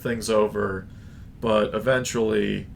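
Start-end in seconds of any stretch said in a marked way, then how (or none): none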